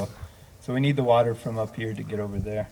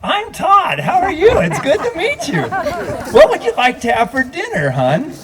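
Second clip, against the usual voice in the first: imitating Todd; 6 dB louder than usual. Minister imitating Todd greeting him at the sink, high and amused. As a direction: play the unruffled husband, cheerful and high. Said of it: "and goes".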